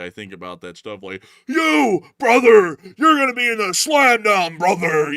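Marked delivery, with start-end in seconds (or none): 1.5-5.1 roaring, high wrestling promo shout; drawn-out, hammed-up ringside yell; WWE wrestler. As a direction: bellow like a hyped-up pro wrestler cutting a promo. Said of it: "every time I think about W W E".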